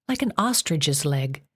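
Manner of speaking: ordinary delivery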